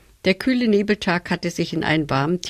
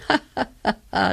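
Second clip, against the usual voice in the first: high, haughty stage laugh ha ha ha; arrogant laugh; 3 semitones higher, faster than usual. A narrator's reading. Chrysanthemum mocking the flowers with a haughty laugh. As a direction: laugh down at the others, smug and superior. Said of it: "lachte die Chrysantheme".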